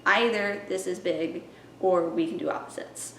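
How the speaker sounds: ordinary talk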